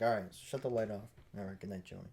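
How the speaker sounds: low, soft sign-off; soft goodnight. Retold or imitated. Friend leaving drunk Joey in bed, softly saying goodnight.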